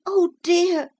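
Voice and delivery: put-on high boy voice; sorry little boy. A narrator's reading. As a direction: sympathetic child. Boy answers mussels.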